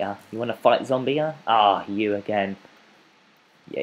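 ordinary delivery

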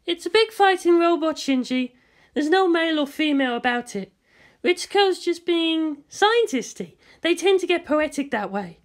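high, breezy woman's voice; lightly mock the scientist, high and chirpy; Misato's voice. Misato teasing Ritsuko to Shinji, high and breezy.

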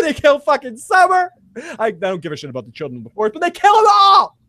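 ranting overwhelmed by the episode; emotional outburst. Real, not acted. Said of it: "I fucking cried".

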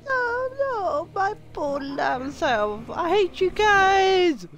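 loud high wail, slow; high anguished cry. Pretend. Lamb being sacrificed.

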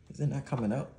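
ordinary talk

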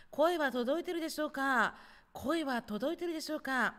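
high, projected call to unseen viewers. Real, not acted. Checks whether viewers can hear. (高めの声で呼びかける)

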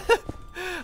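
a quick high chuckle; brief amused laugh; short high laugh. Real, not acted. Laughing at own slip.

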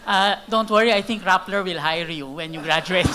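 laughing. Real, not acted. Judge jokes, laughing loudly.

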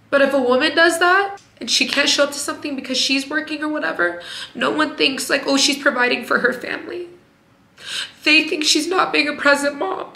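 high, crying voice; pushing words out through tears; teary. Real, not acted. Explaining the double standard to viewers, teary.

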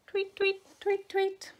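very high chirps; chick tweeting. A narrator's reading. Chicks in the nest, high tweeting. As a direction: cheep like baby birds.

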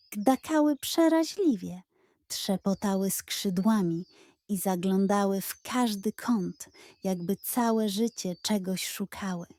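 ordinary delivery, nothing marked

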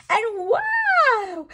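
high, squealing wow; overjoyed storybook gasp of wonder; delighted wow. Acted. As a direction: gush over the newborn chick. Chick hatches from egg.

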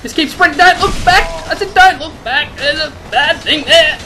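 high, loud frantic warning; panicked yelling. Real, not acted. Gamer fleeing the bad thing behind him, panicked yelling. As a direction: shout it while fleeing in panic. Calling out while fleeing a monster.

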